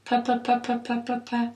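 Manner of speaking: quick, even "P, P, P" bursts for a diadochokinetic test; repeated P sound. Shown as a demonstration. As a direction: pop out "P" over and over at a steady rhythm. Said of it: "So it'll sound a little like this".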